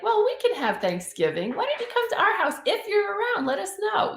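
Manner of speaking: high, bright inviting voice; cheerful hosting offer; welcoming invite. Retold or imitated. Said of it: "instead of offering".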